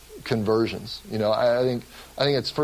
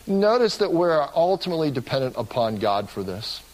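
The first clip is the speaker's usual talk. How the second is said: ordinary talk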